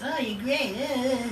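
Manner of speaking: high mocking sweet voice; high mock flattery; fake-nice mimicry. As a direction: mimic a two-faced flatterer. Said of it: "play both sides of the fence".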